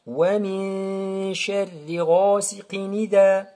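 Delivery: slow, sustained chant, "ghasiqin" flowing into "idha"; correct linked reading. Shown as a demonstration. Teacher repeats Warsh reading, linked "ghasiqin idha". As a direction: hum "min", drop the hamza of "idha". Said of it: "On dit".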